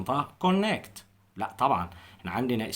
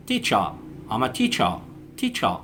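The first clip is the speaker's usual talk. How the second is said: British accent. Shown as a demonstration. Teacher demonstrates British teacher to learners. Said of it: "في البريتش هننطقها".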